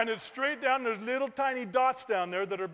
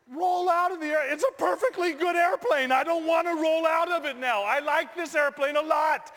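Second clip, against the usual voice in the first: high, loud comic outrage; hammed-up high-pitched objection; panicked protest; 7 semitones higher, 5 dB louder than usual. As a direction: shout it in disbelief at the instructor. Acted panicked protest to instructor.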